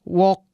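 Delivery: "Walk" without the final t; learner's mispronunciation. Acted. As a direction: say "Walk", leaving off the past-tense ending. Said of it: "bukan walk, walked". ("Walk" tanpa bunyi t di akhir)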